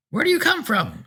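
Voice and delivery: possessed driver insists he is fine, thin and piping. A narrator's reading. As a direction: pipe it out like a frail eighty-year-old. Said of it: "in a thin, piping voice".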